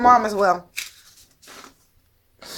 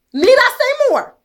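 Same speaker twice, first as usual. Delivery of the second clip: pitched way up and louder, indignant; exasperated high cry; 11 semitones higher, 5 dB louder than usual. Real, not acted. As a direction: fling the question up high in disbelief. Rhetorical climax of her case.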